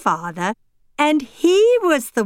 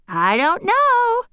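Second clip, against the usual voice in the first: put-on lightly higher girl voice, unsure; a small girl who isn't sure; Tiffany, young girl; 10 semitones higher, slower than usual. Tiffany answers Annabel, a bit unsure.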